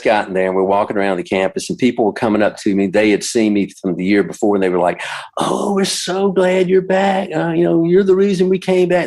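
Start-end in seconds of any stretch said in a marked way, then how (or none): 5.4-9.0 well-wishers welcoming back their favourite singer; gushing fans. Retold or imitated. Camp fans greeting him warmly.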